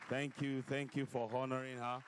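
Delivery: loud, ringing preacher voice; fiery preacher sermon. Acted. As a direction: preach it out to the hall. Preaching at full force.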